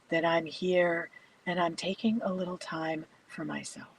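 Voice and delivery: soft, unhurried meditation voice with long pauses; guided meditation. Acted. Yoga teacher guiding a bedtime class into stillness, soft meditation voice. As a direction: settle them gently into stillness, speaking softly.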